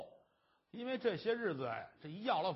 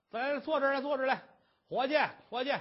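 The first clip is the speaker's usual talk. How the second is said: 招呼; 7 semitones higher, 7 dB louder, faster than usual. Acted. 差人招呼伙计.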